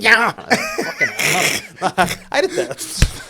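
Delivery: high, slow, loud laughing yell; laughing shout. Real, not acted. To a friend, admitting she smacked a rabbit, howling with laughter. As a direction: howl with laughter at your own story.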